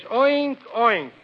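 high first oink, falling second; pig oink. Acted. Reading a farm primer aloud, imitating a pig's oink. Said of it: "and the pig says".

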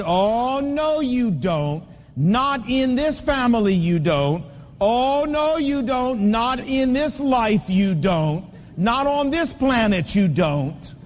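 each "you don't" pressed down firmly; a father setting a hard limit, measured and stern. Retold or imitated. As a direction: lay down the law to your child, steady and firm. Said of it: "got in their face and said".

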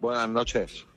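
soft, drained greeting; quiet and worn out; weary tone. Real, not acted. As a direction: say good evening as if exhausted. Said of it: "¿Y ese tono?".

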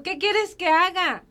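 retold angry demand. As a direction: drag out the exasperated question in mock fury. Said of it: "o sea, tan enojada".

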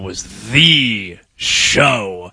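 "the" drawn out long as "thee", then "show" stretched; slow right down and lean on "the show"; stress on "the". Real, not acted. Host recalling SportsCenter's heyday, slow heavy stress on "the show".

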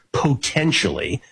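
"potentially" said slowly and leaned on, every syllable given weight; stress on potentially. Real, not acted. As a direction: lean hard into "potentially" and stretch it out. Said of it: "and I stress the word potentially".

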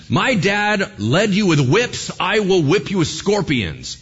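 slow, loud slogan that bursts into a shout; a shouted tyrant's motto; mock campaign shout. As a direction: bellow it like a rally cry.

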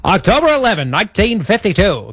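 high, theatrical vintage radio announcer; old-timey radio voice. Shown as a demonstration. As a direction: announce it like a 1950s broadcaster. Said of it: "do the next bit in the old-timey radio voice".

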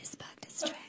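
mock conspiratorial whisper. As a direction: hush it like a secret.

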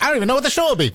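high-pitched mock panic; mock worry. Quotes their own frequent worry.

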